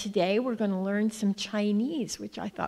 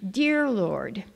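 usual speaking voice

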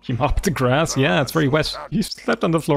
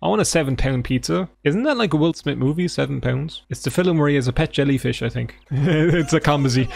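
ordinary talk, nothing marked

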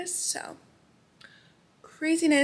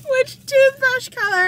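laughing; 14 semitones higher, 12 dB louder, slower than usual. Retold or imitated. Retelling dentist's chatty question.

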